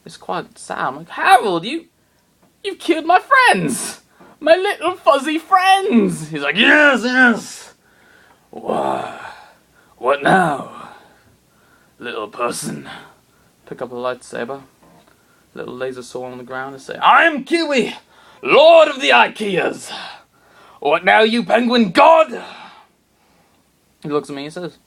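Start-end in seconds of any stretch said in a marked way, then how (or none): 1.1-6.3 much higher and louder, slowed with grief and rage; shouting grief at the villain who killed his rats; anguished outcry. Acted. Accuses Harold of killing rats. 6.6-12.9 gloating villain. Acted. Harold taunts him. 17.0-22.4 much higher and louder, slow and grand; heroic battle cry. Acted. Heroic declaration with sword.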